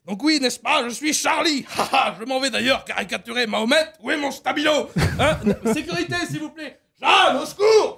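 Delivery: put-on loud, pompous old politician; a loud, hammed-up Le Pen; Le Pen impression. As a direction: declaim grandly with n'est-ce pas. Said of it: "Jean-Marie Le Pen".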